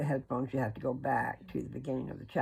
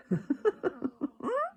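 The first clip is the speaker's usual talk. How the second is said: high giggle; 13 semitones higher than usual. Real, not acted. Laugh after showing the app.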